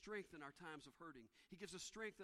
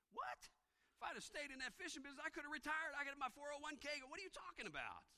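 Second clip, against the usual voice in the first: high, louder indignant fisherman griping; a preacher hamming up a put-out disciple; mock disciple complaint; 10 semitones higher, 5 dB louder than usual. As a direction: whine like a fisherman who gave up his pension. Preacher voices the disappointed disciples.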